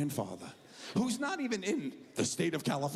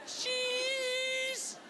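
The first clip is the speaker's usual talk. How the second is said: very high, stretched-out squeak; scared kid's squeal. Retold or imitated. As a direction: squeal it like a terrified child posing. Scared son in family photo.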